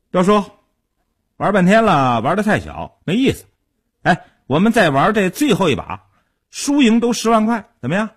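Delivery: insistent, high-pitched challenge; pressing challenger. A narrator's reading. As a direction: goad the older man into one last bet. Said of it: "阿生逼视着傻彪说道".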